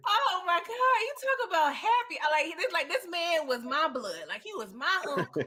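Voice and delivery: loud, high-pitched thrill; excited gushing. Real, not acted. Viewer thrilled for the singer as if kin, loud and excited. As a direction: gush like a proud relative.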